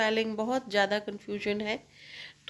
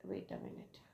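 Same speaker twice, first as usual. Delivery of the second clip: near-whispered self-interruption; muttered aside; 19 dB quieter, much slower than usual. Real, not acted. Teacher pausing herself while writing, muttered under the breath. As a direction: drop almost to a whisper, as if to yourself.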